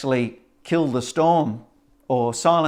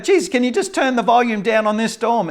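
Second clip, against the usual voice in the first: high, pleading plea over the storm; pleading disciples; 10 semitones higher, 4 dB louder than usual. Acted. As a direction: beg him to turn it down, pitched up. Disciples voiced asking Jesus.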